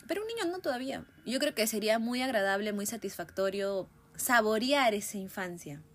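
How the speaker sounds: normal talking voice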